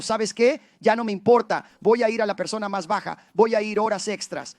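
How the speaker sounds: loud, fervent preaching. Acted. Pastor preaching to the church, loud and fervent. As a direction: drive the message home to the congregation.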